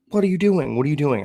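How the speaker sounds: high, loud imitation of alarm; alarmed demand. Roommate grabbing him at the freezer, imitated alarmed shout. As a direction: re-enact an urgent challenge, starting high then easing down.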